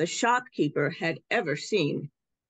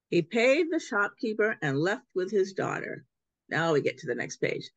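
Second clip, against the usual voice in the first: usual speaking voice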